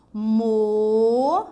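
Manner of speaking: "Mo-" held long and loud with a closed rounded o. Shown as a demonstration. Teacher contrasting it with sola, held closed O. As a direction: stretch "Mo-" on a closed vowel, rising at the end. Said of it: "esse O já é fechado".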